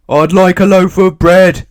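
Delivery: loud, high boom. A narrator's reading. Mister Noisy booms at the baker's wife again. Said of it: "he boomed".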